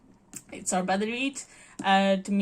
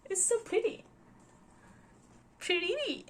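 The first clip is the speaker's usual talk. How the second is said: gushing over pretty; 11 semitones higher, 5 dB quieter than usual. Real, not acted. Gushing over the box set.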